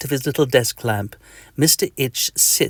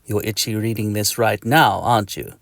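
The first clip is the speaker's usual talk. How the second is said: ordinary delivery, nothing marked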